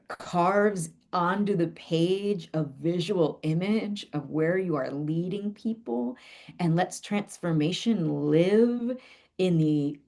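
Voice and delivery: usual speaking voice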